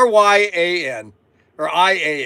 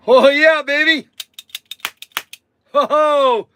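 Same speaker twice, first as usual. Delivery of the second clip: higher, louder cry of delight; gleeful; 7 semitones higher, 5 dB louder than usual. Real, not acted. Showing off the cap gun, gleeful and loud. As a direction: let the joy burst out.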